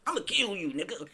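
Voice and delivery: high, loud goofy threat. Retold or imitated. Podcaster mocking [name], goofy yelled threat. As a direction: ham up a silly gangster threat. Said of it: "[name] goofy ass was just".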